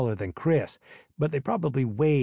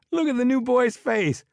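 high, laughing through the words; giggling while pointing out his face; teasing laugh-talk; 12 semitones higher, 4 dB louder than usual. Acted. Laughing at the new boy.

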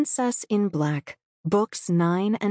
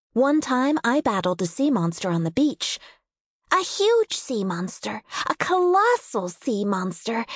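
cartoon princess; 5 semitones higher than usual.

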